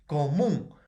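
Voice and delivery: "común" with a loud, stressed MÚN; stress on last syllable. Shown as a demonstration. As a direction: punch the final syllable of "común". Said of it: "es una aguda que termina en N".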